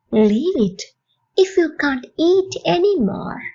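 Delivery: slow, evenly spaced teaching delivery; slow model phrase. Shown as a demonstration. Teacher to English learners, slow model phrase. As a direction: say it slowly for learners to copy.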